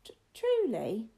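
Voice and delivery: high, halting, drawn-out question; nervous stammer. Acted. Boy asks if the ghost is real, nervous stammer. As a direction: stammer on the first sound, then stretch it.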